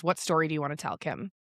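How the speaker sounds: ordinary talk, nothing marked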